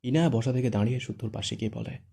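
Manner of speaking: plain reading voice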